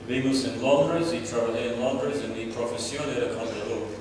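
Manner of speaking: ordinary talk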